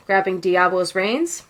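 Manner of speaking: plain reading voice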